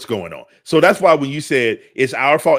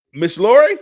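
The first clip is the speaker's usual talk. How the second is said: high, loud rising call; voice going up; 10 semitones higher, 8 dB louder than usual. Real, not acted. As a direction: call her name with a steep questioning lift. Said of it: "when my voice go up like that".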